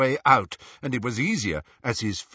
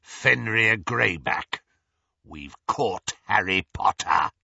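resentful werewolf. A narrator's reading. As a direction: growl the name with resentment. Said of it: "resentment in the werewolf's voice".

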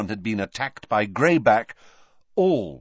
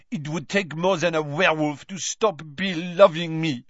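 put-on high, proud French-accented line; 6 semitones higher than usual. A narrator's reading. Fleur to Mrs Weasley, proud French accent. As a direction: rise to full height in French. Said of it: "drawing herself up".

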